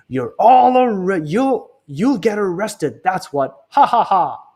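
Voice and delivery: high, slow jolly voice with a laugh; Mister Dart catching the thieves; gloating museum director. Acted. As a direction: crow over the arrest.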